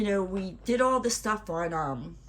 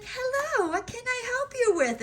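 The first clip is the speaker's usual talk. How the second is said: much higher, sugary imitation of a friendly phone agent; sweet lady. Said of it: "this very sweet, wonderful lady".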